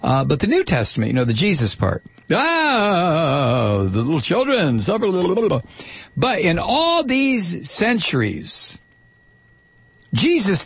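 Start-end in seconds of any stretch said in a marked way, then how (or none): 2.3-5.6 mock pious quote. Mock quote of Jesus' teaching.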